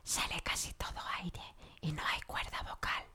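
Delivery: all air, no voice; very soft, fully whispered demonstration. Shown as a demonstration. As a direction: whisper it with barely any vocal cord. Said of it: "es cuando susurras".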